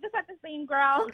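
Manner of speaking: giggling through the words; laughing. Retold or imitated. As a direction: laugh while imitating the host. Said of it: "you're gonna be like".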